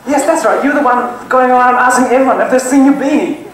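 normal talking voice

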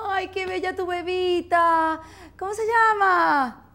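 high, drawn-out gushing; sugary exaggerated admirer; mock baby cooing. Acted cooing over a friend's baby.

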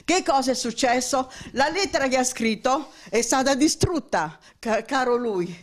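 ordinary talk, nothing marked

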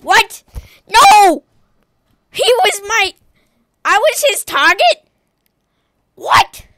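high, loud cries of shock; real shocked outburst; outraged disbelief. Real, not acted. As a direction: yell it out in stunned protest. Killed by own target.

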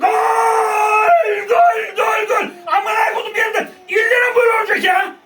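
ecstatic screaming fan; screaming goal celebration. Real, not acted. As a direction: scream it like the winning goal just went in.

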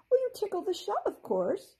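high, amused fairy voice; high fairy, lightly amused. A narrator's reading. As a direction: explain it like it's obvious. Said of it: "she said".

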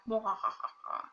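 low villain ha-ha-ha; mock evil laugh. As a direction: play an evil Santa's laugh. Announces being the anti-Santa.

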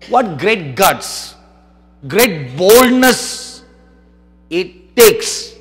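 stretched and loud for awe; slow emphatic preaching. Real, not acted. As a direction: drag out great guts, great boldness. Preacher stresses bomber's courage.

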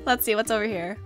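normal talking voice

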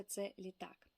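usual speaking voice

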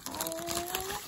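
very high, soft "aww"; touched coo. Real, not acted. Vlogger finding a save the bees pin, touched coo.

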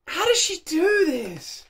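amazed disbelief. Real, not acted. Overwhelmed by the singing.

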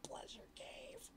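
breathy secret whisper. Pretend. Repeating the book's phrase, a hushed whisper.

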